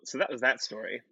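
ordinary talk

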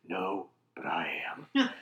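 low, soft whispery one-liner; quiet, menacing action-hero voice; action movie hero. Acted. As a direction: drop to a deep hush for the punchline. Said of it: "so nineteen eighties action movie".

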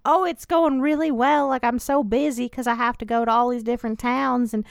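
put-on voice, pitched slightly up; mock impression of an author talking himself up; Quentin. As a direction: imitate a busy author's chatter. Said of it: "That's what he sounds like".

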